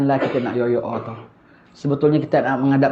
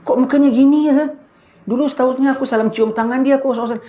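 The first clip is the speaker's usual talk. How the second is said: very high, startled voice of the dead; the dead student recognizing his ustaz, high and shocked; 10 semitones higher than usual. Retold or imitated. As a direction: gasp it out high in disbelief. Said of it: "Sekali dia tengok".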